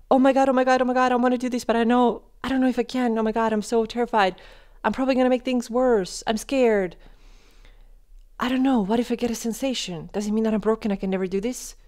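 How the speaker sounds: high, fast, frantic worrying voice; panicked anxious self-talk. Retold or imitated. Acting out a panicked inner voice before a workout. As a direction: spiral into panic about the workout. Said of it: "So if your language is like that".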